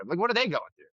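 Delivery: ordinary delivery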